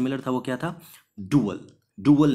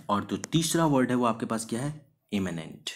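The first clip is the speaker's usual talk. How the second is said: ordinary talk, nothing marked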